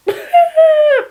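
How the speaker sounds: high, loud mock sobbing wail; hammed-up high-pitched crying; imitated breakdown wail. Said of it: "break it down, like".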